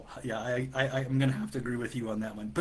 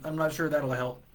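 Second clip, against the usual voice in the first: ordinary delivery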